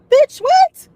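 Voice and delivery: high, loud shocked squeal; shocked outburst. Retold or imitated. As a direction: blurt it out in total disbelief. Shock at broken tooth.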